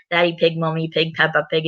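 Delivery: ordinary delivery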